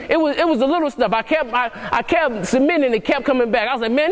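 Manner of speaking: normal talking voice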